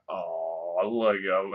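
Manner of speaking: low, soft drawn-out "oh"; mock sympathy. Viewer reacting to Liam rejecting her, mock sympathy.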